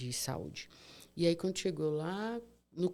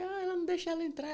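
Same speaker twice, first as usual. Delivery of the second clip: high, fast tattling voice; complaining sister; 12 semitones higher, faster than usual. Retold or imitated. Sister quickly complaining to the brother about the nurse, high and fast. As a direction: rattle off the complaint.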